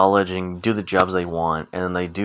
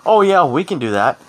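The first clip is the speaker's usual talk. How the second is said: mock know-it-all classmates; 8 semitones higher than usual. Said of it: "everyone else is all like".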